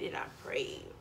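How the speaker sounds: fading to a whisper; whispered fade. Acted. The last prayer to viewers, whispered. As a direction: drop to a whisper.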